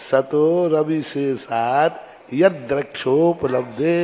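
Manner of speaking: slow chant, यद with a doubled द; slow verse chant for students; slow recitation demo. Shown as a demonstration. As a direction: draw out the verse slowly, one word at a time. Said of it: "सद".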